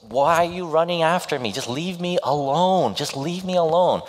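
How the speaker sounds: high pleading woman's voice; heartbroken woman voiced. Heartbroken heroine to the man chasing her, high and pleading. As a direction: voice her begging him to go away, pitched high. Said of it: "and she goes".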